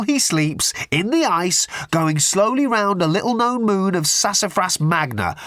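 high-pitched, worked-up relish; gloating explanation. A narrator's reading. Ford explains his revenge on the sleeping man, gloating.

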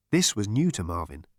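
plain reading voice

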